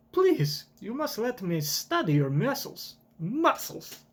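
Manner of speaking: high, pleading mad-scientist voice; high eager scientist. Acted. Vegapunk begging to study Kuma's muscles, high and eager. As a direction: beg like an obsessed scientist.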